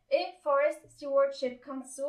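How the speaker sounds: mock English accent; self-mocking English accent. Shown as a demonstration. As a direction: read the council's name with a put-on English accent. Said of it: "avec mon magnifique accent anglais".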